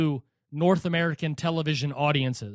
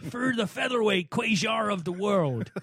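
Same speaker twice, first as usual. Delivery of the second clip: mock announcer; 5 semitones higher than usual. Host mocking announcers mispronouncing, hammed-up announcer. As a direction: imitate a TV caller butchering Cuellar.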